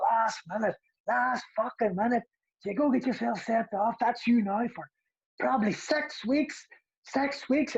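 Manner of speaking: high-pitched raging rant, a little slow; manager's rant. Retold or imitated. Manager ranting at the sent-off player, high angry rant. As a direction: berate him for getting sent off. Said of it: "He's like".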